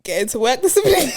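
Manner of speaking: high-pitched laughing exclamation. Real, not acted. Co-host jokes about their kind of discipline, laughing. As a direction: call it out, breaking into laughter. Joking answer, bursts out laughing.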